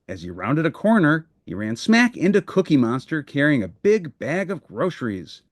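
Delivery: ordinary delivery, nothing marked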